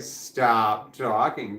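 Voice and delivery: slow, stretched-out stop talking; drawn-out mock lawyer order. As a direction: drag it out like a nagging inner voice. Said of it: "He's like".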